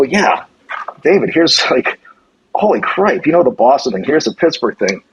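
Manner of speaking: excited, unsure rush. Retold or imitated. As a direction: pour out the dilemma eagerly. Torn between two job offers.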